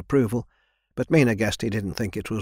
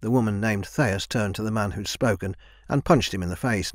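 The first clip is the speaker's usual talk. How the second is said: ordinary delivery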